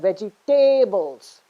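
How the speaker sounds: vegetables as slow vegi-TAAY-bles; stress on third syllable. Shown as a demonstration. Teacher exaggerating the mistake, vegetables slowly as vegi-TAY-bles. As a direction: drag out and punch TAY in vegetables. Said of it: "You're stressing on the third syllable".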